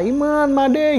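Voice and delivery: high call with a rise on the last name. Retold or imitated. Calling friends again from the slope, high call. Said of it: "Gue panggil lagi mereka".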